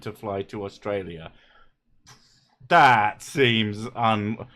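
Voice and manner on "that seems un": normal talking voice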